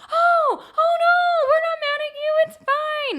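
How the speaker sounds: hammed-up gentle reassurance; mock reassuring husband. Imitating her husband calming her, high and soothing. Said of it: "He's like".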